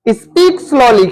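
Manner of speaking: drawn out very slowly, word by word; slow demo. Shown as a demonstration. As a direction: stretch each word out to show slowly. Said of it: "धीरे से बोलो".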